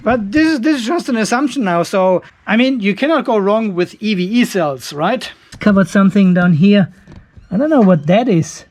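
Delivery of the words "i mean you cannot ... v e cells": usual speaking voice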